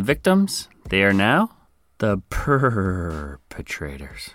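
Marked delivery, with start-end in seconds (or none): 2.0-4.3 dramatic hushed. Acted. Dramatic pun payoff.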